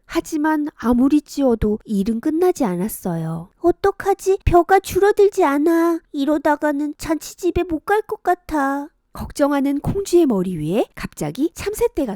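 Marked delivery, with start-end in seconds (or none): 3.6-8.9 put-on high, fretful girl's voice; worried girl. Kongjwi worried over the rice, high girl's voice. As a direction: fret over endless work.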